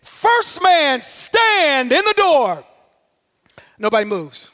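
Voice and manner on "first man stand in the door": high, slow, drawn-out command; instructor's shouted order. Retold or imitated. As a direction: holler the order, stretching key words. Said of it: "Then you hear this".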